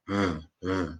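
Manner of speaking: soft "V, v" with teeth on the lip; v sound demo. Shown as a demonstration. Teacher showing learners the v sound. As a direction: buzz "V, v" gently on the lip. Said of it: "I make the v sound".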